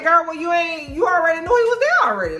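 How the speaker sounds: high-pitched, disbelieving challenge; incredulous calling-out. Retold or imitated. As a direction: call out a friend's obvious fib. Narrator calls out woman's denial.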